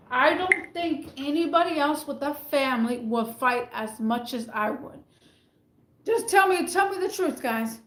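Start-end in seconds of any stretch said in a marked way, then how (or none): 6.0-7.4 high, insistent demand for the truth; high insistent plea; pleading challenge. Real, not acted. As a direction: push the viewers to answer honestly. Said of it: "challenging the viewers".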